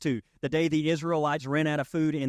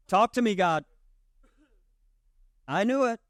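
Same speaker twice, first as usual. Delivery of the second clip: mock eager; 6 semitones higher, 3 dB louder than usual. Mocking believers demanding answers.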